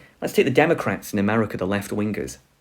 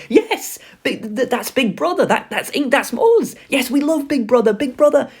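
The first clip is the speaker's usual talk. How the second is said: much higher, gushing devotion; fanatical happy crowd; 11 semitones higher, 4 dB louder than usual. Retold or imitated. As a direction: swoon over Big Brother like a zealot. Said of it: "they turn happy".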